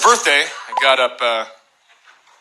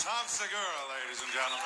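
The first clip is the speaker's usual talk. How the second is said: announcer; 20 dB quieter than usual. Real, not acted. Announcer introducing comic.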